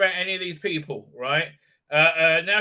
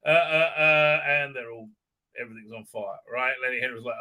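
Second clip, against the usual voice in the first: ordinary talk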